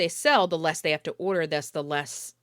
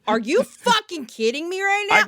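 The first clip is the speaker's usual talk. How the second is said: much higher and louder, furious; mock outraged customer; 13 semitones higher, 6 dB louder than usual. Customer gets plain pasta, furious shout. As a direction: explode at the waiter in disbelief. Acted angry customer, no cheese.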